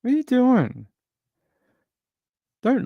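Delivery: very high cooing question. Real, not acted. Owner asks cat what it's doing, high coo. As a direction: squeak it at the pet.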